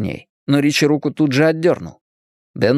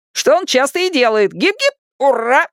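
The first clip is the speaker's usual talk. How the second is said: put-on high boyish jeer ending in a cheer; cheeky high-pitched mock cheer; wisecracking boy, cheering; 13 semitones higher than usual. A narrator's reading. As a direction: toss it off, then whoop. Narrator plays wisecracking Richie.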